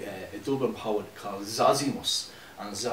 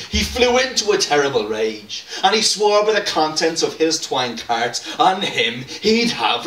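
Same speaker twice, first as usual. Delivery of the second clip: loud, lilting street-poet recital, higher; ballad recitation; 4 semitones higher, 7 dB louder than usual. Acted. Poet performing Zosimus's ballad to the crowd, loud and declaiming. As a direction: belt it out like a Dublin street poet on a corner.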